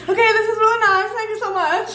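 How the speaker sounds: high, hurried mock-nervous thanks; scared politeness. Thanking a murderer by her car, acted nervous politeness. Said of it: "I was just so scared".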